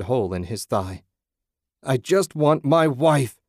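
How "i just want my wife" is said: loud, high and slow bellow; wounded husband bellowing at the monsters. A narrator's reading. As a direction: roar it out in pain. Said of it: "he bellowed".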